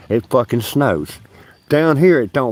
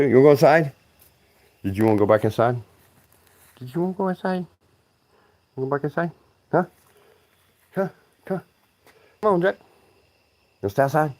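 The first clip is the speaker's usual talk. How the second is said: talking to the dog; 4 semitones higher, faster than usual. Real, not acted. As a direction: coax the dog gently. Talking to pet outside.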